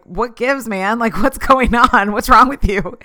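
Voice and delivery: a fed-up call-out; confronting him. Retold or imitated. To the belittling colleague, a loud exasperated challenge. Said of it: "I just was like".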